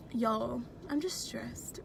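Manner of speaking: confiding in the viewers while driving; whispered. Real, not acted. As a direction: let it fall to a hush. Confessing stress to viewers.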